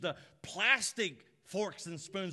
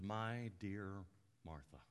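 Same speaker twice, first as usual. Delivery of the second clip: soft, slow, low gentle address; Jesus gently calming an upset friend; tender Jesus; 8 semitones lower, 9 dB quieter, slower than usual. Acted. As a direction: say her name like you love her.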